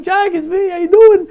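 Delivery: high, loud overjoyed greeting; joyful reunion. Retold or imitated. Greeting his childhood mentor at the bris, loud and overjoyed. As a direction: burst out with delight at seeing him.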